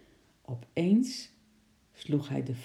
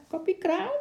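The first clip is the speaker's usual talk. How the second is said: very high, crowing parrot voice. A narrator's reading. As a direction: crow it like a gleeful parrot, rising at the end. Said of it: "kraaide Lorre". (hoog kraaiende papegaai)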